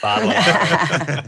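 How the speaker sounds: "Bottle of water" drawn out with a laugh; laughing Australian "Bottle of water". Shown as a demonstration. Echoing the Australian "Bottle of water" while laughing.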